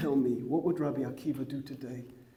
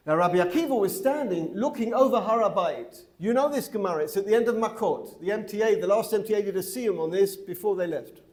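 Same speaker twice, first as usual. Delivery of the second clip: loud, higher, emphatic preaching delivery; impassioned raised voice; 5 semitones higher, 7 dB louder than usual. Real, not acted. Teacher answers a challenge about the Omer, impassioned and loud. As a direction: project it with rising fervour.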